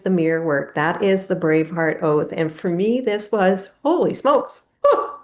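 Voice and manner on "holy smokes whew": overcome. Real, not acted. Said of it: "I'm getting emotional".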